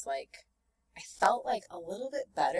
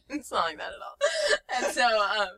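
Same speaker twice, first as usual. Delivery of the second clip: laughing; 5 semitones higher than usual. Real, not acted. Laughs at her own naivety.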